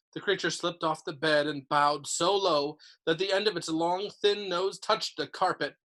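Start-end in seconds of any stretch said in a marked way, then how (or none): none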